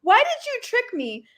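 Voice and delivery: high-pitched, plaintive imitation of an angry customer; mock indignation. Duped customer confronting the cashier, imitated indignation. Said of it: "He was so mad at me".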